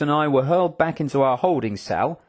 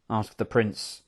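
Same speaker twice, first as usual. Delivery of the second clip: usual speaking voice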